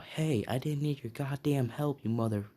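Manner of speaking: put-on a cocky boy snapping at helpers, half-hearted; mock yell. Hot-headed boy yelling at classmates who helped, mild mock yell. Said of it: "this boy yells out".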